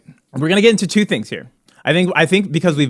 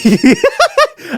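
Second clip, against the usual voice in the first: high burst of laughter; 4 dB louder than usual. Real, not acted. Cohost laughing hard.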